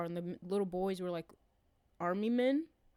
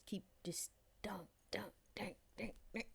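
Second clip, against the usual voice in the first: hushed whispered chant; whispering; 12 dB quieter than usual. Pretend. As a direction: whisper it under your breath. Miming dunking someone, whispered.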